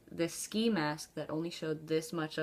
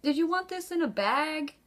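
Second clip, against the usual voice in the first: high, perky, louder cashier offer; much higher, chirpy polite cashier voice; overly polite cashier; 10 semitones higher, 5 dB louder than usual. Retold or imitated. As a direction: serve the robber like any customer. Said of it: "I'm like".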